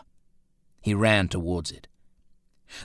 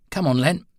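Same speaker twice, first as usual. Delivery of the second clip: higher, louder urging call; 8 semitones higher, 4 dB louder than usual. A narrator's reading. Roland coaxing his reluctant dog, urgent call. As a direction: coax the stubborn dog forward. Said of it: "he urged".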